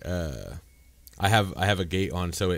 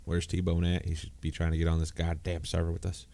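ordinary talk, nothing marked

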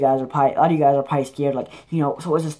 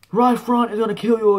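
higher, louder lightly voiced wrestler threat; wrestler's threat; 6 semitones higher, 3 dB louder than usual. Action figure wrestler threatening a rival, higher mock voice. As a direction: ham up a wrestler's threat in a put-on voice. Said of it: "make him say".

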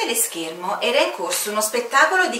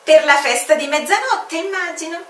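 high, dry guess; lightly ironic official; knowing commander; 9 semitones higher, 6 dB louder than usual. Acted. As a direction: say it with a knowing edge. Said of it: "disse il comandante".

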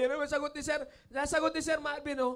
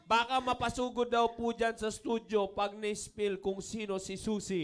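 slow, lower read-aloud voice; reading a warning message; 4 semitones lower, slower than usual. Retold or imitated. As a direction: read it out slowly like an official warning. (mabagal na pagbasa ng babala)

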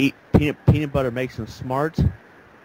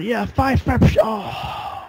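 high mock chant of a crowd wanting a fight; imitating a crowd cheering "fight, fight"; egging on a fight; 10 semitones higher, much slower than usual. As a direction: chant for the brawl, then fizzle out. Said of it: "cheering him on".

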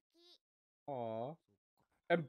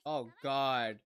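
usual speaking voice

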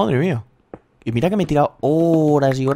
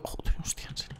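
stunned whisper; 14 dB quieter than usual. Real, not acted. Stunned at diamond find.

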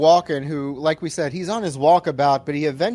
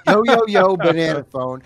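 louder playful echo; 5 dB louder than usual. Retold or imitated. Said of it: "repeating the quote laughing".

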